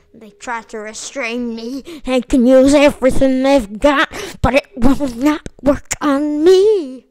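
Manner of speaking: loud villain boast, slowing at the end; loud, hammed-up boast; mock defiance. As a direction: bellow it like an unstoppable brute. Defiant boast about restraints.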